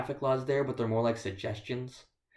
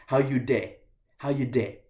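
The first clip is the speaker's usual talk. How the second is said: slightly louder, clipped pidgin greeting; West African pidgin; 4 dB louder than usual. Shown as a demonstration. Showing American listeners the local pidgin greeting. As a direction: demo of Ghanaian pidgin greeting How you day. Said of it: "a pidgin English or a broken English".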